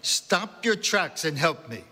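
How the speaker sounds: put-on high, drawn-out call in a Central European accent; old woman's Central European accent, pitched high; strong Central European accent. A narrator's reading. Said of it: "she called out in a strong Central European accent".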